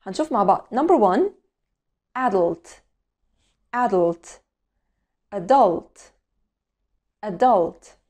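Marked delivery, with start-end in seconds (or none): 2.2-6.1 adult in British English, first syllable stressed like "AD-ult"; careful British accent demo. Shown as a demonstration. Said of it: "هننطق الأول البريتش أكسنت". 7.2-8.0 adult in American English as "uh-DULT", stress on the second syllable; American accent. Shown as a demonstration. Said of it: "وبعدين الأمريكان أكسنت".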